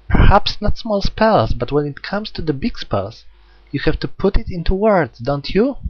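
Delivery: put-on high, uncanny childlike musing; eerie girl voice. Jack probing Hyoma about spells, eerie girl voice. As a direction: tease him like an innocent little threat. Jack's voice.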